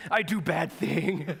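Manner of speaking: slow, halting mock confession; mock guilt. Pastor friend realizing her failure, comic guilt. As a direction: own up in hammed-up shame.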